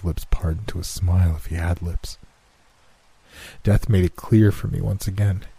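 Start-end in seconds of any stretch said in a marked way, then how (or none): none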